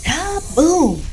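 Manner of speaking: high, loud explosive cry; booming cartoon crash shout; holler. A narrator's reading. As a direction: holler it like a blast. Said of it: "he hollered".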